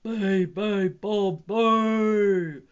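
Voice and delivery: booming moose chant; big, heavy animal bellow; thundering. A narrator's reading. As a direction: thunder each syllable out. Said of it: "thundered the moose and the water buffalo".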